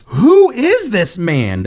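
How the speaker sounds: high, loud, slow awed question; scared amazement. Acted. Amazed disciples asking about Jesus, acted awe. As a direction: stretch it out in stunned wonder. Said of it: "Basically scared. The disciples were amazed".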